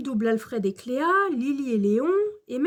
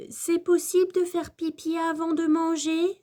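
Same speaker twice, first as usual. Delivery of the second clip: put-on higher, mildly worried child's question; Cléa inquiète; 5 semitones higher than usual. A narrator's reading. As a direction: ask with a touch of worry. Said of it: "s'inquiète Cléa". (petite question un peu inquiète)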